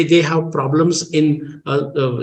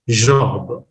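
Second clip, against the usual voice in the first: British pronunciation; 5 semitones lower than usual. Shown as a demonstration. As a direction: say "job" the British way. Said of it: "British speaker can".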